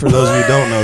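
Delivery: ordinary delivery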